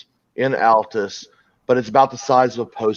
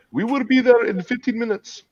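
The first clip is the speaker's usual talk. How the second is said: cab dispatcher voice; 10 semitones higher than usual. Retold or imitated. Cab dispatcher quoted on phone.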